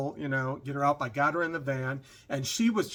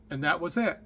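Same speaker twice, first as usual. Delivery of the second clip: ordinary delivery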